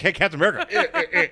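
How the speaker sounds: giggly mock greeting; a hint of laughter in the voice; laughing quote. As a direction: greet him while chuckling. Joking Giant Man quote.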